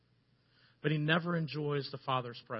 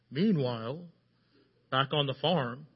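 mock movie narrator, slightly lowered; 2 semitones lower, slower than usual. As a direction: announce the scene change like a film voice-over. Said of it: "I had this narrator voice".

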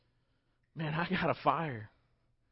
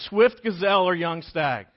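loud, slow, savoring praise; excited bride; 3 semitones higher, 10 dB louder than usual. Acted. Bride praises groom as he walks up, loud and slow. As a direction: draw out each word lovingly. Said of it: "that's what you want your girls to say".

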